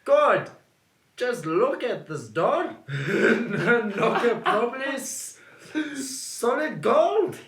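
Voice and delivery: slow, impressed drawl; David gaping at the door; awed nephew. Acted. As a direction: marvel at the knocker.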